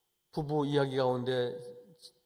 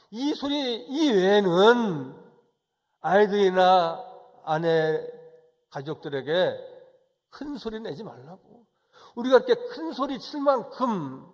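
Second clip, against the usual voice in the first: loud, slow, emphatic sermon voice; insistent preacher's delivery; forceful preaching; 5 semitones higher, 9 dB louder than usual. Real, not acted. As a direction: press each phrase on the congregation. Preaches with raised voice.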